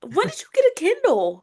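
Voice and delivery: high, incredulous questioning; mock-offended surprise. Real, not acted. Friend quizzing friend on when she got a Kindle, mock-offended surprise. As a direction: demand it in a high, playfully scandalised voice.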